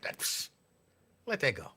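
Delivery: a hushed, secretive psst to the crowd; conspiratorial hush. Pretend. Mock conspiratorial aside to audience.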